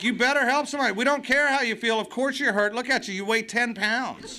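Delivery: high-pitched, exasperated sponsor imitation; mock tough-love telling-off; mock scolding sponsor. Sponsors scold the hurting newcomer.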